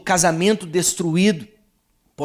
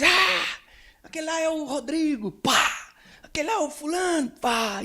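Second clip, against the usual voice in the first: menino animado; 11 semitones higher than usual. Retold or imitated. Menino atira carvão brincando.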